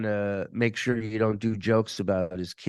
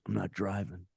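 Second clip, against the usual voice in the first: low mutter; 3 semitones lower, 9 dB quieter than usual. Retold or imitated. Thug's curt reply, retold.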